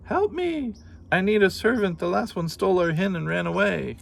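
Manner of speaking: very high, loud giantess shriek; giantess roaring. Acted. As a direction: cry out like a frantic giantess. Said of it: "she roared".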